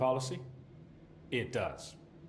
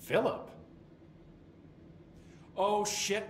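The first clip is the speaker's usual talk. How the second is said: startled realisation; 7 semitones higher, slower than usual. Acted. Suddenly remembers forgotten man.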